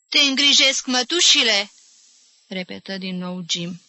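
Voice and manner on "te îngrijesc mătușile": high, loud question; raised voice. A narrator's reading. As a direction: call it out for someone hard of hearing. Repeating loudly to deaf old woman.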